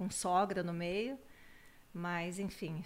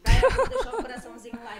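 put-on high, loud, laughing baby voice; 14 semitones higher, 13 dB louder, faster than usual. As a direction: squeal it like a giggling baby. Grandma voicing the baby, laughing.

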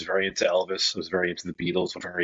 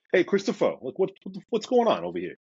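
playful mock Brooklyn accent, much higher; 11 semitones higher than usual. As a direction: slip into a hammed-up Brooklyn accent calling out. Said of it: "I become a little bit more Brooklyn".

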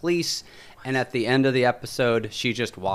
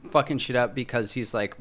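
ordinary talk, nothing marked

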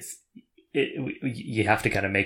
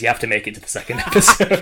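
loud, laughing mock insistence; 10 dB louder, faster than usual. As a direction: laugh through the words, insisting. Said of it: "all anybody ever says is".

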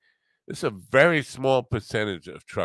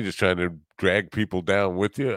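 ordinary talk

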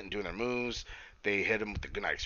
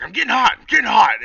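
much higher, louder mock-furious shout; imitating angry Taz; 12 semitones higher, 14 dB louder than usual. Taz telling Brian Cage he's getting heated, hammed-up angry shout. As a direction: ham up a wrestler boiling over. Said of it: "getting all fucking angry and shit".

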